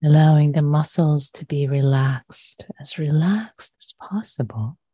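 unhurried, measured meditation voice with long pauses; guided meditation. Real, not acted. Meditation teacher inviting listeners to settle their posture, calm and unhurried. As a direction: guide gently into stillness, leaving long silences.

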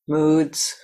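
normal talking voice

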